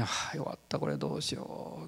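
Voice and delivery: hushed, low fretful mutter to himself; worried mutter. Retold or imitated. Injured preacher in patrol car fretting to himself, hushed mutter. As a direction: mutter it under your breath, at a loss.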